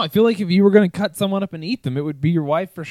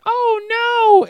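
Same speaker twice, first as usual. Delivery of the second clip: high, loud cartoon cry of dismay; mock panicked cucumber; 5 dB louder than usual. Cucumber grabbed by the chef, mock panic. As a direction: squeal it as a terrified vegetable. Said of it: "it's like".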